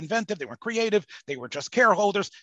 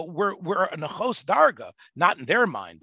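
normal talking voice

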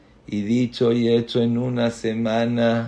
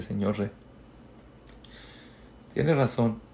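voice breaking. Acted. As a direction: hold back tears, mild. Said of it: "se empezó a quebrantar su voz".

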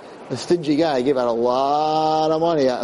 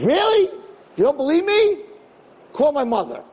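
put-on defiant kid; 12 semitones higher than usual. Kid daring the storyteller to call, high and indignant. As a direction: squeak it out like a cheeky teenager.

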